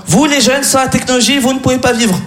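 mock sermon from a know-it-all patient; moralizing old patient. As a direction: lecture the youth like a grumpy old patient. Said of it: "très moralisateur".

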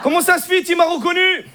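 put-on high Moroccan-accented French, puzzled; Hicham. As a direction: caught out, in a thick Moroccan accent. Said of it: "t'as l'accent".

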